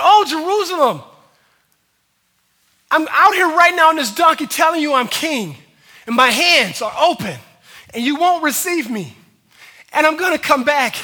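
anguished cry out over a city; high, full-voiced pleading as a grieving king; impassioned, preached lamentation; loud acted lament. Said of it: "the reason why he was crying".

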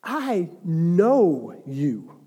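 I KNOW YOU, each word stretched very slowly; God speaking emphatically. Acted. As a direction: draw out "know" long and deliberate. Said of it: "things that God is trying to say".

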